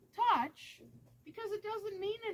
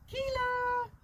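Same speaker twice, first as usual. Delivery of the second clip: calling the dog; 8 semitones higher than usual. Shown as a demonstration. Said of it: "I'm gonna say it in a few different tones".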